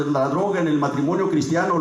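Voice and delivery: ordinary talk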